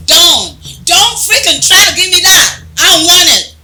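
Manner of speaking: loud, high, furious shouting. Acted. A betrayed friend rejecting the apology, furious shout. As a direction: throw the apology back in her face.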